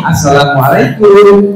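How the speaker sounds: model greeting. Shown as a demonstration. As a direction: draw out "Assalamualaikum" for copying.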